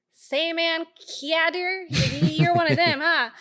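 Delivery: mock Texan Uber driver, loud and high; Texan accent. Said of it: "got that fucking accent".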